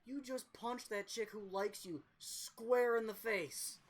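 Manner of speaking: lightly voiced bro teammate; teammates mocking. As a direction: drag it out like a ribbing teammate. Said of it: "a few of the guys on the team go".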